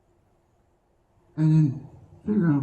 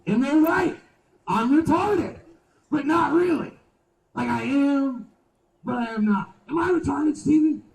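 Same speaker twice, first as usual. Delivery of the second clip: much higher and louder throughout; loud high rant; 14 semitones higher, 6 dB louder than usual. Acted. Comic making fun of himself, loud high rant. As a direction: belt it up high at the crowd. Shouted self-mockery at crowd.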